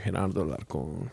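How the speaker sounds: low, soft and sultry; seductive name. Pretend. Savoring his own name, low and sultry. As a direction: purr your own name. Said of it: "You sound sexy as fuck".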